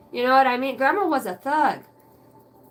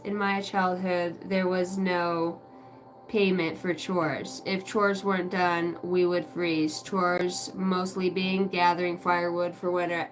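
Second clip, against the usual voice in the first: slow, lower, measured reading; reading aloud; 4 semitones lower, 3 dB quieter, slower than usual. Real, not acted. Reading Chad's comment on childhood chores, measured. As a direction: read it out slowly, phrase by phrase.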